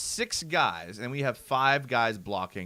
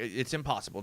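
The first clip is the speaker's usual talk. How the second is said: ordinary talk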